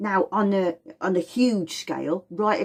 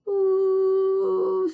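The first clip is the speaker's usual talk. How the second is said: drawn-out flat ooh; 12 semitones higher than usual. Shown as a demonstration. Imitating flat line.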